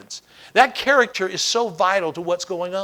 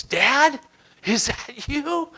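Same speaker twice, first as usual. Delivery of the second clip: put-on higher, tentative child's question; trapped son's voice; 5 semitones higher, much slower than usual. Son under rubble answers his father, small questioning voice. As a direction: call up slowly, unsure who is there. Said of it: "He heard this voice say this".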